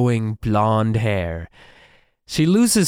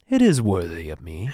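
lower, mock-pompous self-regard; 5 semitones lower than usual. Co-host mimicking Riot admiring the estate, pompous. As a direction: play a self-satisfied pompous man, pitched down. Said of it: "Such a pompous ass".

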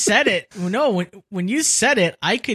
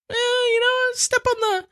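much higher, revved-up hype; high excited voice. Acted. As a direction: squeal it like a revving engine. Hyping like a racer.

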